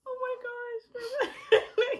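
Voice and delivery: slow, high, laughing and half-crying; laughing through it. Retold or imitated. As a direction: drag it out while laughing. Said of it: "and we're like".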